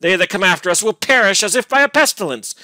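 loud, high, bitter mockery; fiendish scorn. A narrator's reading. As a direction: jeer furiously, voice soaring. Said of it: "still with the same fiendish scorn".

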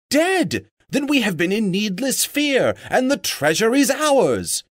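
high, exultant cry; jubilant shout. A narrator's reading. Dwarves learning the dragon is dead, high joyful shouting. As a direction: shout it out in a burst of joy. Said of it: "shouted the dwarves".

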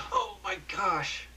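high, slow exclaim; astonished cry; startled gasp. A narrator's reading. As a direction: leap up in shock. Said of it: "Ted jumping up".

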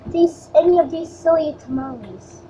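ordinary talk, nothing marked

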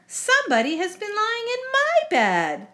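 high-pitched indignant mother bear; crying out. Acted. Mama Bear finding her bed slept in, high indignant cry. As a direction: lift the pitch right up, aghast. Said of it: "cried Mama Bear".

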